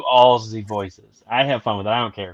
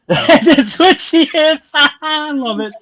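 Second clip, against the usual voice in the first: high, slow words through laughter; delighted, squealing laughter; laughing hard; 10 semitones higher, 5 dB louder, much slower than usual. Real, not acted. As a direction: crack up while saying it. Cracking up at the character's voice.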